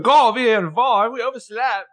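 high, loud, lightly voiced boyfriend; Jordan quote. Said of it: "And he's like".

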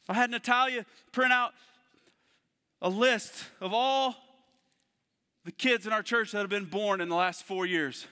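barely keeping it together, voice rising; emotional, near tears. Real, not acted. Pastor on his son's baptism, choked up and loud. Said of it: "I'm trying not to cry".

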